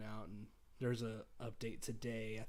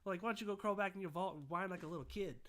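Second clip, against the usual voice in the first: high, taunting bad Boston accent; worst Boston accent; 9 semitones higher, 3 dB louder, faster than usual. Cosplayer taunting the tunnel snakes, bad Boston accent. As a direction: jeer back in a hammed-up Boston accent. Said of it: "in my worst Boston a-, like, accent".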